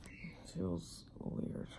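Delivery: hurting, whispering through a sore mouth; barely voiced after wisdom teeth; pained whisper. Real, not acted. Whispering in pain after surgery.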